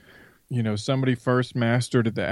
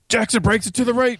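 mock play-by-play excitement, pitched up; imitating hyped sportscaster; 12 semitones higher, 3 dB louder, faster than usual. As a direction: call it like an overeager announcer. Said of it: "Their tone goes up, the volume goes up".

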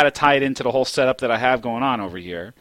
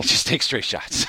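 laughing. Real, not acted. Laughing answer.

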